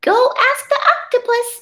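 high quick starfish voice. Acted. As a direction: chirp it fast, up high. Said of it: "said the starfish".

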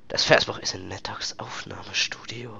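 whispered mission text; hushed, sneaking whisper. Real, not acted. Sneaking mission, reading quietly.

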